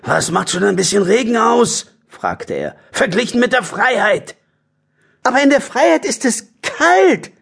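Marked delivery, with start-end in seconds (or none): 0.0-1.9 raised-pitch character voice; dismissive badger voice. Harry the badger chides the others about rain, dismissive voice. 2.9-4.4 raised-pitch character voice; dismissive badger voice. Harry the badger chides the others about rain, dismissive voice. 5.2-7.3 high, whiny badger complaint; complaining. The other badgers object to Harry, high complaint. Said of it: "beklagten sich die anderen Dachse".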